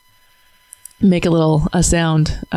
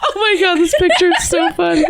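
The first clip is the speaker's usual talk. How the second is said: laughing. Real, not acted. Laughing hysterically at a picture.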